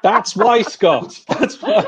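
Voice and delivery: high, bursting with laughter; laughing. Real, not acted. Teasing Scott about filters, laughing through it.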